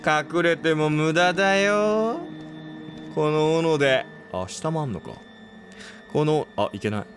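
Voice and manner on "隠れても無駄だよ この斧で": very slow, sweetly menacing taunt; creepy axe killer. Pretend. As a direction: drag each word out like a villain. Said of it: "みたいなこと？". (斧を持った殺人鬼がねっとりと脅す)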